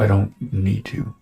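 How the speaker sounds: ordinary talk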